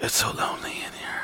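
whispered mock self-pity; lonely whisper. Man alone on the flight, lonely whisper. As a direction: whisper it forlornly to yourself.

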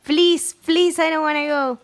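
high pleading please. Retold or imitated. Peter's last plea to Tony, high begging. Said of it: "Le dice".

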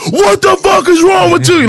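high, loud furious yell; angry shout. Retold or imitated. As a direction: scream it in his face.

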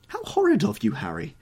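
very high, loud exclamation; duchess's scandalised cry; high outcry of mock-horrified reproach. A narrator's reading. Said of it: "cried the Duchess".

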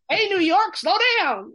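loud, high call across the room; hollering at the fast-talking New Yorker; high, loud mock holler. Arkansas locals calling her out.

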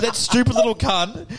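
slow, high-pitched indignant insult; worked-up retold grumbling; aggressive venting. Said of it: "You were really aggressive towards this girl".

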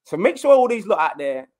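rally the room like a captain; fired-up rallying speech. Retold or imitated. Player vowing to the gaffer that the squad stays, fired up.

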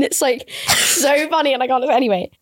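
ordinary delivery

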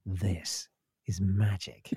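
hushed whisper, slow; whispered radio ident. Retold or imitated. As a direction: breathe it like a night DJ. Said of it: "They just went".

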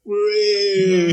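high mock whoops of celebration; mock cheering. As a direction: cheer it like a crowd, tongue in cheek.

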